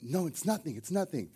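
soft, small imitated voice; quietly acted bedtime hush; hushed reassurance. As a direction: keep it down, as if in a dark bedroom. Imitating kids whispering in the dark.

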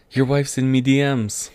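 a shaky stab at an Irish brogue; Irish accent. Retold or imitated. Mimicking McGregor's mic taunt in a half-hearted Irish accent. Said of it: "do an Irish accent".

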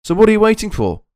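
excited ad pitch. Acted. Podcast ad read, pitch jump.

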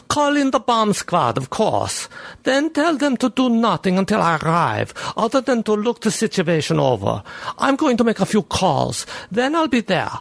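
brisk commanding chief; police chief giving orders. Acted.

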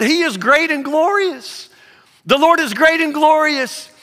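rising, urgent sermon cadence; fervent preaching. Acted. Pastor urging the church, building preaching. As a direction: preach it out to the congregation.

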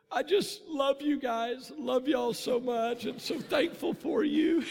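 mock teary, high and slow; tearful love. Son tells his parents he loves them, teary and high. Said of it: "and I'm just crying".

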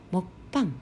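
モッパン in Korean with a stopped t before pang; モッパン, held stop in the middle; Korean mukbang. Shown as a demonstration. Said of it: "モッパンという言葉".

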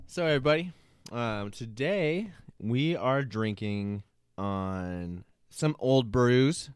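slow, drawn-out show host opener; announcer intro. Acted. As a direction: welcome the audience like a host.

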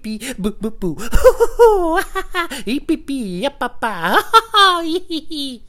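high nonsense babbling with squealed laughs; mock comedian babble. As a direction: parody how everyone talks trying to be funny. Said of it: "a pattern of the way that they speak".

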